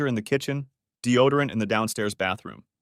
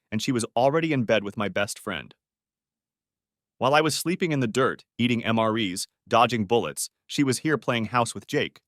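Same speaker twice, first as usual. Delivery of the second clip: ordinary delivery, nothing marked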